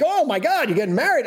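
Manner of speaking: high-pitched retold gasp of surprise; astonished reaction. Producers reacting to his wedding news, astonished. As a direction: mimic their amazed outburst.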